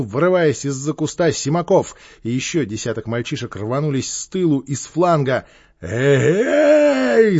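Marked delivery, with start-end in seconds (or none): 5.8-7.4 long, high, loud holler; yelling. A narrator's reading. Gang leader under attack, yelling. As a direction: yell it out, stretched and soaring. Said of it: "заорал Квакин".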